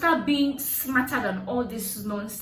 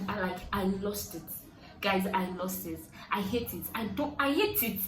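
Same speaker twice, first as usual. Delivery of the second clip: ordinary talk, nothing marked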